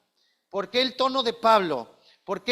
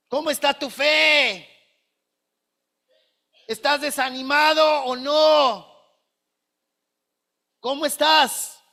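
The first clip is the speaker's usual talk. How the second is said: higher, louder, slow caring questions; big emphatic question; 4 semitones higher, 4 dB louder than usual. Shown as a demonstration. Preacher asking the church about their faith, loud and pleading. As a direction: ask it like a big red question mark. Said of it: "que pones el signo de interrogación rojo".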